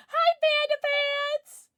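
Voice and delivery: very high, loud, drawn-out hello; gleeful high-pitched shout; excited greeting. Real, not acted. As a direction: squeal hello to an arriving friend. Calls out to arriving viewer.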